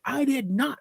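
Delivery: high-pitched retort; indignant denial. Retold or imitated. Dad denies son's accusation, indignant retort. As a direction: deny it firmly, acting out the squabble.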